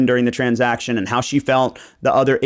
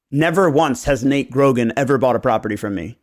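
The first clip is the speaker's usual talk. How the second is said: ordinary talk, nothing marked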